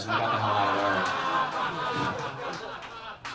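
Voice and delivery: slow, laughing punchline. Real, not acted. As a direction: drag it out laughing.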